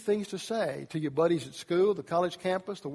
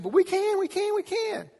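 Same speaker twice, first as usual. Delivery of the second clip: much higher squeaky we can; high eager voice; 14 semitones higher than usual. Retold or imitated. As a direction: plead with excitement. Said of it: "And two said".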